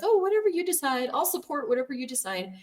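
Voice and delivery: high, sing-song eager-to-please voice; meek people-pleaser. Retold or imitated. Ex-Witness wife deferring to husband, high and meek. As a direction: chirp it like a brainwashed yes-woman. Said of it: "brainwashed ex-Jehovah's Witness".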